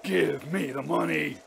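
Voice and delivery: high, drawn-out furious accusation; half-stifled anger. A narrator's reading. Father demands money from his son, in stifled fury. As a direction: force out a slow, stifled demand. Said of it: "cried the other in a half-stifled voice".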